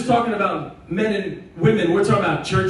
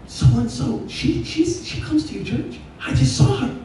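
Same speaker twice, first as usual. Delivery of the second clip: higher, amazed gossiping voice, mock surprise; surprised gossip; 3 semitones higher, 3 dB quieter than usual. Churchgoers gossiping to the pastor, mock surprised. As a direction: gasp at the news like a churchgoer. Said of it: "people come up like".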